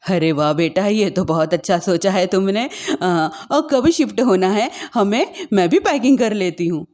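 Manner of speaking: put-on eager older woman; happy old mother. Mother voiced by narrator.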